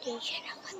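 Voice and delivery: hushed spotting whisper. Real, not acted. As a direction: whisper it under your breath. Whispered sighting.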